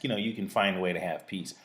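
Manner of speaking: normal talking voice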